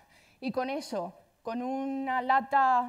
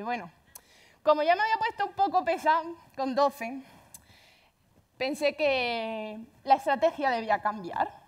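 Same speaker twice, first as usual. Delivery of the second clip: usual speaking voice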